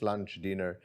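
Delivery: ordinary talk, nothing marked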